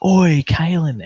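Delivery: loud drawn-out call to get his attention. Retold or imitated. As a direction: hail him from a distance. Calling out to Kalen on camera.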